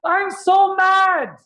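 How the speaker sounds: high, slow furious yell; demo mad scream. Shown as a demonstration. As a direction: scream it out like a wild man. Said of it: "scream like a wild man".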